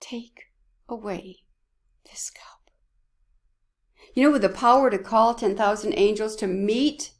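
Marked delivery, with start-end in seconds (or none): 0.0-2.7 whispered prayer. Retold or imitated. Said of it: "As his son prayed".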